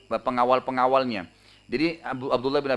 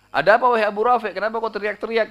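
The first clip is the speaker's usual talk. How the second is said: raised, higher-pitched voice put on; another voice; 8 semitones higher, 6 dB louder than usual. Attacker posing as someone else to Abu Rafi, in another voice. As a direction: imitate a different person calling loudly. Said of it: "dengan suara lain gitu".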